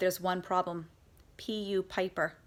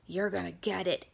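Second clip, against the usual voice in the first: growl; 4 dB quieter than usual. Acted. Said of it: "growls bully head number one".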